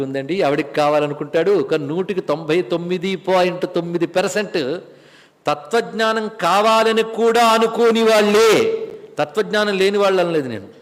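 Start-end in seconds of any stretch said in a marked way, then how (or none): 5.5-8.9 కావాలని కూడా drawn out slowly with force; slow, insistent stress right through కూడా అనుకోని వాళ్ళే; emphatic stress. Real, not acted. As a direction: lean hard and long on కూడా. Emphatic correction of who is meant.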